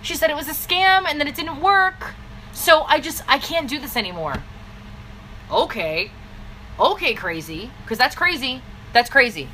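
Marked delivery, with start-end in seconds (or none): 0.0-4.4 mocking a scared recruit who heard it was a scam, whining; whiny mock distributor.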